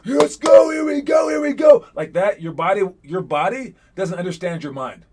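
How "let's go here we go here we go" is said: loud high hyped-up yell; fake hype shout. Shown as a demonstration. Coach showing players a fake hype shout. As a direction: pump yourself up with fake energy. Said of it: "Even if you're just like".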